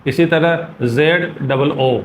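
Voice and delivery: ordinary talk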